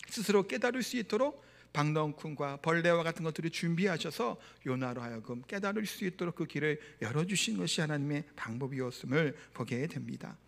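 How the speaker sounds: normal talking voice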